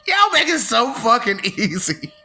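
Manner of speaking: ordinary talk, nothing marked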